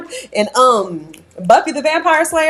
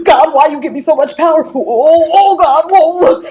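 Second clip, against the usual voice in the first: loud, hammed-up plea to God; overwhelmed fake wailing that climbs in pitch; mock anguish; 4 semitones higher, 6 dB louder than usual. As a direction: melodramatic prayer. Acts out praying about too much power.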